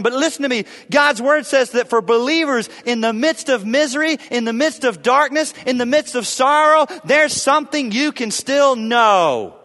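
high, loud, driving proclamation; full-force preaching. Real, not acted. Preacher urges believers there is something they can know, at full force. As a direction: press each phrase harder, then stretch the last.

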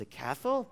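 "castle" with a big lisp, high and rising; lisping toddler. Retold or imitated. Small son asking his dad about "castle", lisping. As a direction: ask "castle" as a small child, s turned to th. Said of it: "Big lisp".

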